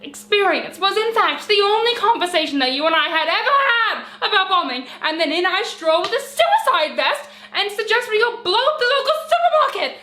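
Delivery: rising, high-pitched disbelief; exasperated incredulity. Acted. Partner confronting sweetheart over imagined suicide vest suggestion, increasingly incredulous. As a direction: build to an outraged peak.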